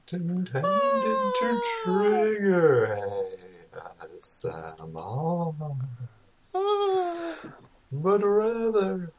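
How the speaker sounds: slow, eerie announcer; spooky host voice. Acted. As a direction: draw it out like a haunted host. Spooky podcast intro.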